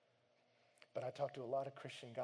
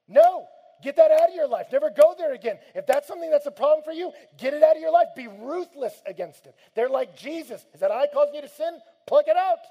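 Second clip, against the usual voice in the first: much higher, shouted commands; fierce preaching; 13 semitones higher, 21 dB louder than usual. Retold or imitated. Mentors rebuking a struggling man, shouted commands. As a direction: drive it home at full force.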